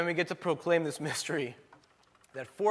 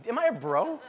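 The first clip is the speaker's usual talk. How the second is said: laughing, rising higher with each repeat; mock disbelief; 10 semitones higher than usual. Pastor laughing at being called a bro, mock disbelief. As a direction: play it up as if shocked at the label.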